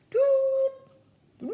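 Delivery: high, loud hooted "doo"; flamingo fluting. Shown as a demonstration. Reader guessing a flamingo's call for children, fluting. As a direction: flute out short high calls like a bird. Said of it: "I think that's what fluting is".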